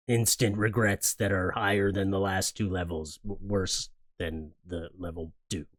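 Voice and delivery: slow, ominous countdown voice; mock-grave host intro; dramatic announcer. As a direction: announce the dreaded level like a trailer. Dramatic announcement of level three.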